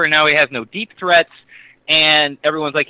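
normal talking voice

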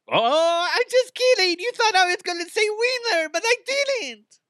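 very high, loud, goofy squeal; breaking into kidding. Pretend. Radio host undercutting his fake threat, high squealed kidding. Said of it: "I would always break into".

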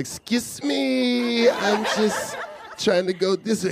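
high, slow, timid politeness with a laugh in it; imitating his own scared polite self; mock polite squeak. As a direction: tiptoe past him in a tiny nervous voice. Retold meeting a gunman on the street.

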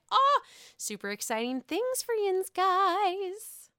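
high and bright hype; excited. Real, not acted. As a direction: announce it with glee. Hyped announcement.

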